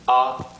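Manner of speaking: short, flat "ah"; quick unstressed "ah"; neutral tone. Shown as a demonstration. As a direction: tap out "ah" lightly. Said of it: "flat and light with no emphasis".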